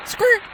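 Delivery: short, very high squeak; brief high-pitched screech; imitated scream. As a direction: squeak out a quick mock scream. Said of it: "me screaming like that".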